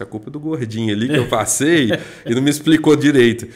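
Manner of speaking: loud, playful blame; joking mock complaint. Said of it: "Aí eu brinquei assim". (reclamação de brincadeira, bem alto)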